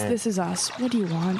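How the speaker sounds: ordinary delivery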